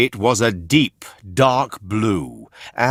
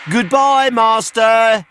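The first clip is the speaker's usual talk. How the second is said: very high, louder called goodbye; calling out; 12 semitones higher, 6 dB louder than usual. A narrator's reading. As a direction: call it out across the water. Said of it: "he called".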